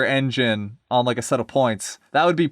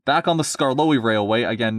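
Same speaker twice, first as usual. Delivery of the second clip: plain reading voice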